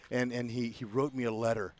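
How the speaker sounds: ordinary talk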